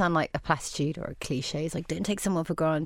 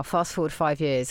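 usual speaking voice

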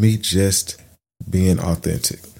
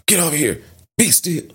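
put-on sharp, much higher barked orders to a child; snapping parent; 9 semitones higher than usual. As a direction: snap at the kid like an impatient parent. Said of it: "that parent snapping".

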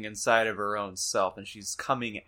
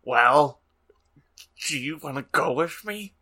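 Draco voice; 3 semitones higher, 4 dB louder than usual. Acted. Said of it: "That, that Draco voice was too much".

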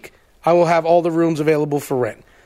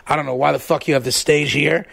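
lower, lightly voiced blunt complaint; 6 semitones lower than usual. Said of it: "my Oasis impression of [name]".

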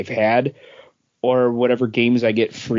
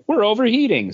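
higher, like a machine announcing a warning; mock console voice; 10 semitones higher than usual. PS4 owner voices the console's overheating warning, mock machine voice. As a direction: play the PS4 complaining it's overheating. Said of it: "'cause it was like".

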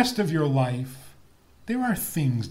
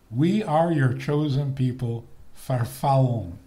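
ordinary talk, nothing marked

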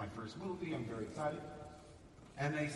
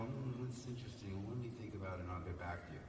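lower, softer noncommittal stalling; evasive director; 7 semitones lower, 6 dB quieter than usual. Retold or imitated. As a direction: brush the actor off politely. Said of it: "they'll go".

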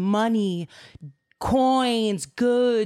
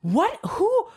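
high, sudden startled question; startled Balaam; 11 semitones higher than usual. Retold or imitated. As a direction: jump at seeing the angel. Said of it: "And Balaam goes".

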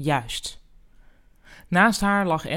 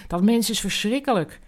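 higher, mildly fuming complaint; 5 semitones higher than usual. Acted. Enzo storms in after the call, mildly fuming. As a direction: vent the irritation, a touch heated. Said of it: "Hij kwam briesend binnen".

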